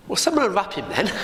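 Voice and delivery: quick, amused, laughing through it. A narrator's reading. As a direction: chuckle it out fast. Jesus' wry line, teller laughs.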